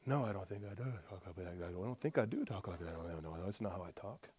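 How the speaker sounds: very soft, low, quick muttering; thinking to himself. Retold or imitated. Preacher replaying his private pondering, muttered to himself. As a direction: mull it over under your breath. Retold pondering, inner dialogue.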